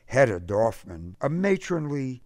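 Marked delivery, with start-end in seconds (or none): none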